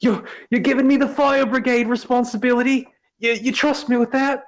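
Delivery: very high, loud, stammering disbelief; can't believe he's trusted; disbelieving Lionel. A narrator's reading.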